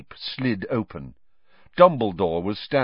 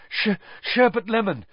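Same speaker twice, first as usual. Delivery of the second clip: panting; 12 semitones higher than usual. Acted. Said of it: "he panted at it".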